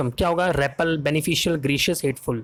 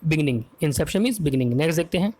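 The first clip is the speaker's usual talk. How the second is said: usual speaking voice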